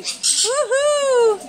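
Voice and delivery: high woohoo cheering the bird on; excited cheer. Real, not acted. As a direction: cheer the bird's dance.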